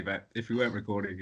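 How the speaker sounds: normal talking voice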